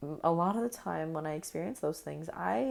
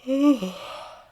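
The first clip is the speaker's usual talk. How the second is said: loud sigh; 7 semitones higher, 8 dB louder than usual. Real, not acted. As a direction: let out a big sigh. Deep release sigh.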